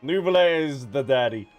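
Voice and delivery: usual speaking voice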